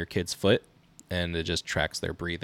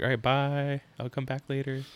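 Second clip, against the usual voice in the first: higher-pitched mock goodbye; acted sign-off; 7 semitones higher than usual. Dad bailing on gaming when his son wakes, higher mock goodbye. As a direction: act out a hasty exit in a lifted voice. Quoting himself leaving the game.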